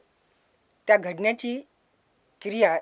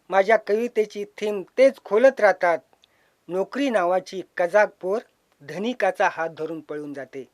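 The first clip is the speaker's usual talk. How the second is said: usual speaking voice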